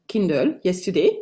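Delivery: ordinary talk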